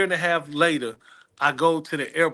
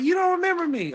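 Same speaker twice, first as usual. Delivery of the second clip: much higher, disbelieving question; hurt surprise. Retold or imitated. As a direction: sound amazed he forgot you. Singer surprised he forgot her.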